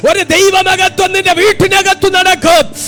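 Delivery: preaching that climbs into a sustained high shout; fervent, shouted prophetic declaration; full-force preaching. Real, not acted.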